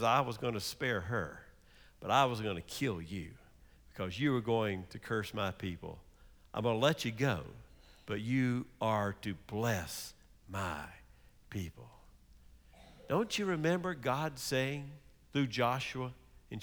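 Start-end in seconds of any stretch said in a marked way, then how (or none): 0.0-11.9 the angel speaking. Acted. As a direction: lower and softer, a solemn stern angel. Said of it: "and he says".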